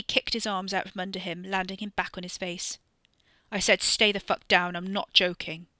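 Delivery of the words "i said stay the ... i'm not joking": loud, stressing said; a warning said a second time, louder on said; repeated threat. Acted. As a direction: hit the word said, firm and loud. Ricky repeats order, warning.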